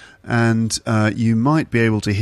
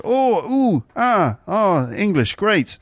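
much higher, drawn-out oohs and ahs; mock delighted listener; 8 semitones higher, slower than usual. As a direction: gasp with cartoonish pleasure at hearing English. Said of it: "those are the noises that you make".

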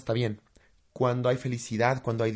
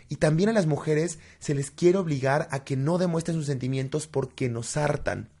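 ordinary talk, nothing marked

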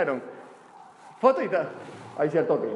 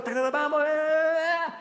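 higher, excited cheering for a horse; race cheering; 11 semitones higher than usual. Retold or imitated. Owner reliving cheering his racehorse on, rising and excited. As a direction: cheer the horse home, pitch climbing.

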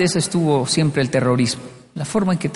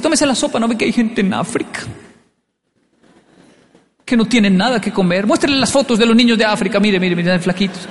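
guilt-tripping mother; 5 semitones higher than usual. Retold or imitated.

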